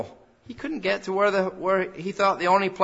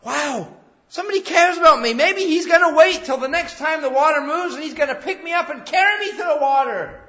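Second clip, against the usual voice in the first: much higher, quick, wondering voice; amazed hope; 9 semitones higher, 5 dB louder than usual. Retold or imitated. Lame man imagining someone will finally carry him, amazed and high. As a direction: gasp with sudden hope. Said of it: "I wonder if this man thought".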